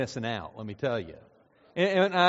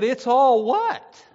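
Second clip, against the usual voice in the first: loud, high, slow puzzled question; confused diner asking what "all" means; baffled "it's all what?"; 8 semitones higher, 8 dB louder, much slower than usual. Retold or imitated. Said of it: "And he said".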